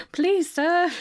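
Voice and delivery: high, pleading orphan voice; begging orphan. Pretend. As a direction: beg like a poor urchin.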